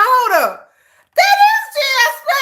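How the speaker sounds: high, fast, laughing shout of recognition; excited. Retold or imitated. Viewer spotting a friend's comment, laughing shout. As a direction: yell it out while cracking up.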